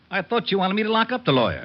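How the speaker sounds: high, exasperated comeback; he's lost the thread and is getting worked up; flustered. Acted. Confused back-and-forth about the lawyer.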